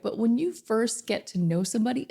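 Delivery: ordinary delivery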